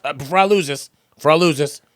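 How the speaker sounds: ordinary talk, nothing marked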